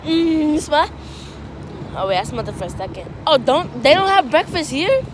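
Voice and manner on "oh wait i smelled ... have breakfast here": usual speaking voice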